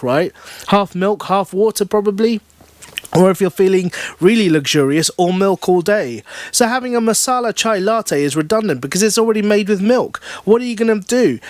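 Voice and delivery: high-pitched pedantic spiel; lecturing customer. A narrator's reading. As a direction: launch into a know-it-all lecture. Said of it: "he launches into a whole spiel".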